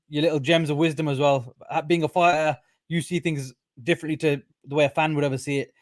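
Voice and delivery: ordinary talk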